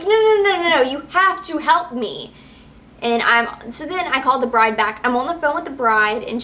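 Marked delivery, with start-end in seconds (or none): 0.0-2.3 high, pleading no no no; higher, pleading protest; retold frantic plea. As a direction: stop her from leaving, desperate. Pleading as clerk walks away.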